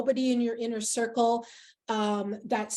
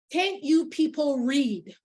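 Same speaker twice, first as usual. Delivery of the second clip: slow, raised yell, higher pitched; imitated yell; 4 semitones higher, slower than usual. Older woman to a confused mother in line, imitated yell. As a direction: call it out at the confused shoppers, exasperated. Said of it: "literally she yelled".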